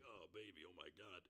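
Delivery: low, hushed lover's murmur; mock lustful moan. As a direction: imitate a lover, pitched well down and soft. Retold lover's groan in sex scene.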